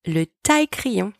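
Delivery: ordinary talk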